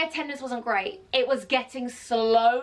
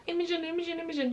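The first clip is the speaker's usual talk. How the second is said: higher, softer mock crying voice; imitated tearful phone call; 6 semitones higher, 5 dB quieter, much faster than usual. Upset friend calling the speaker for comfort, imitated crying. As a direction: plead the name over and over, imitating a crying friend. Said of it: "She's crying, crying, crying".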